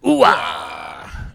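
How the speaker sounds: loud, low, put-on Pacino bark; loud low mock Pacino hoo-ah. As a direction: shout it like an old Pacino. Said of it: "the sound he makes when he comes".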